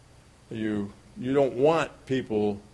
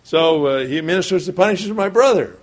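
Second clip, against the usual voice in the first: usual speaking voice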